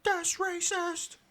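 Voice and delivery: very high, drawn-out squeaky cry; mock outrage. Co-host jokingly objecting to the comfort food remark, mock outrage. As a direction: stretch each word out in a silly high voice.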